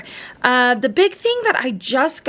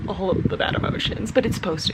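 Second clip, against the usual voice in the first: ordinary talk, nothing marked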